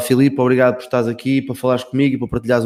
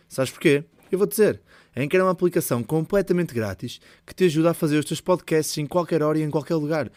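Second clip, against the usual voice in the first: higher, upbeat sponsor read; 4 semitones higher, 4 dB quieter than usual. Acted. Podcast host reads a sponsor ad to listeners, upbeat ad read. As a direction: sell the app to the listener.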